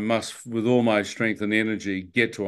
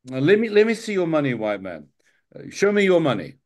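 higher, faster, demanding taxi driver; pushy taxi driver; 6 semitones higher than usual. Retold or imitated. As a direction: demand the cash like a pushy driver sizing up a tourist.

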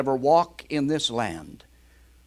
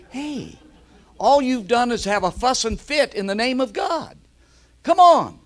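much higher, louder rebuke; preaching rebuke; 10 semitones higher, 5 dB louder than usual. Real, not acted. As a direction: call them out hard, rising to a shout.

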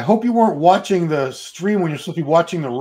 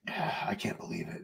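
whispered disbelief; 9 semitones lower, 15 dB quieter than usual. Real, not acted. Marvelling at daughter's age.

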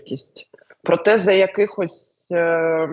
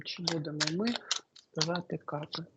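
the same question again, much softer; quiet musing; 14 dB quieter than usual. Real, not acted. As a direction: mull it over under your breath. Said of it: "repeats the question softly". (тихо, в роздумах, ніби сама до себе)

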